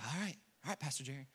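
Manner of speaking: very soft, low mock acquiescence; reluctant giving in. Mourner giving in to Pastor Jerry, quiet reluctance. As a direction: give in quietly, as if humouring him.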